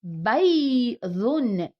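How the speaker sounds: بيض drawn out very slowly; slow model word. Shown as a demonstration. Teacher models بيض slowly for the class. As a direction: stretch بيض, ending firmly on ض. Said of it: "وهنا".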